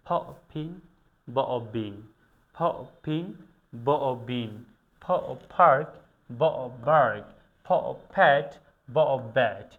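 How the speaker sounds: ফ and ব sounded alone, then ফিং, বিং, ফ্যাট, ব্যাট said slowly and clearly; teacher drilling each ফ and ব word carefully; phoneme drill. Shown as a demonstration. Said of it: "এগুলোকে এভাবে উচ্চারণ করতে হয়".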